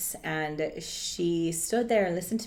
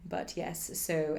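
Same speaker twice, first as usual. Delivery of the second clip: ordinary talk, nothing marked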